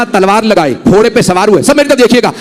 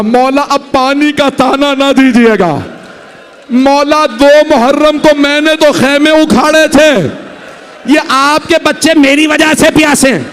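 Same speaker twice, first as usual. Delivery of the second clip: anguished plea; 5 semitones higher than usual. A narrator's reading. Repentant warrior pleads with his lord.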